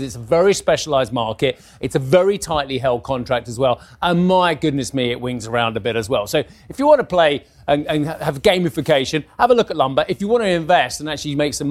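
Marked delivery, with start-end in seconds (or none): none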